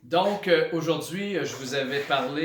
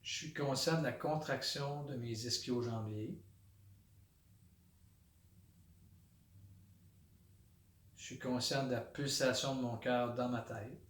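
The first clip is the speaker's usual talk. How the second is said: soft, low meditation voice with long pauses; hushed, unhurried body-scan guidance; guided meditation; 3 semitones lower, 8 dB quieter than usual. Shown as a demonstration. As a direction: settle into quiet, centred awareness, naming each sensation softly. Leads a body-scan meditation aloud.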